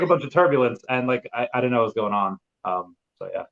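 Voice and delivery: ordinary delivery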